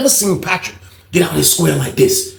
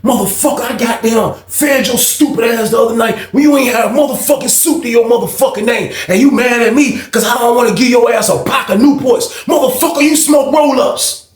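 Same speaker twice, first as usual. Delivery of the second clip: high-pitched, indignant retold tirade; arguing; 8 semitones higher than usual. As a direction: act out his heated rant, pitched way up.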